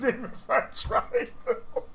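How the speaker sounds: laughing through the words; helpless chuckling admission; amused. Real, not acted. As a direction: break into real laughter by the end. Laughing at failing a surname.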